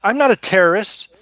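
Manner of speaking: put-on high, loud protesting boy; a kid insisting he's innocent; young kid voice.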